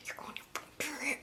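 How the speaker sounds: sighing whisper under the breath; whispered aside. Real, not acted. Vlogger's exasperated whispered aside to viewers. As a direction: hiss it to yourself in exasperation. Muttered aside about friend's lie.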